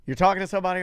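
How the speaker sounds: ordinary talk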